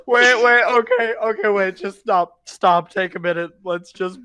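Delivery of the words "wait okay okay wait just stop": ordinary delivery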